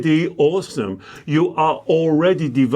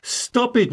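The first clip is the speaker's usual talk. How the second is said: higher, firm "Stop it"; earnest order to quit; sincere command; 8 semitones higher than usual. Real, not acted. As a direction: tell them straight, meaning it. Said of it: "I couldn't say it with more sincerity".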